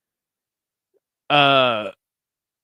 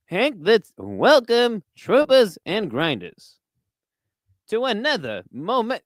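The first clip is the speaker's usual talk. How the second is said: pompous broadcast opening, word by word; Howard Cosell; 4 semitones higher than usual. Acted. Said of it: "when you do that voice".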